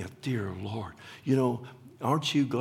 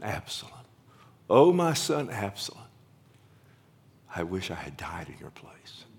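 grieving cry; 3 semitones lower than usual. Acted. Preacher voicing David mourning Absalom, crying then whispered. David weeping over his son.

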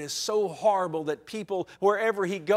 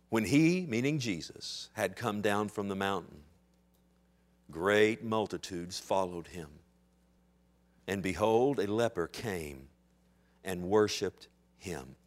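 hushed scripture reading; 11 semitones lower, 7 dB quieter than usual. Acted. Preacher to the congregation, reading Matthew softly. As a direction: read it gently, reverently. Reads Matthew eight aloud.

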